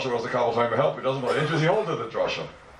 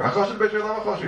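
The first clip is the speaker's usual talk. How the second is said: Hakosher de beitrei lav hakosher hi rattled off quickly and higher; fast higher quote; 7 semitones higher, faster than usual. Shown as a demonstration. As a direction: fire the line off fast, pitched up.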